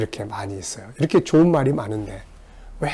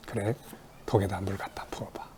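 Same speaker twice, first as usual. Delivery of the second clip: hushed, gentle whispered instruction; soft whispered advice; 4 semitones lower, 14 dB quieter than usual. Retold or imitated. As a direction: tell them softly what to do. Jesus answering at the wedding. (예수님이 어부들에게 속삭이듯 다정하게)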